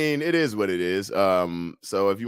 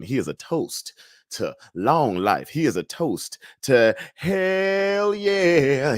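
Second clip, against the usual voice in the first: slow toast; 3 semitones higher, much slower than usual. Acted.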